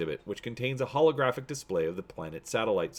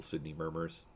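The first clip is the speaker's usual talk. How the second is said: ordinary delivery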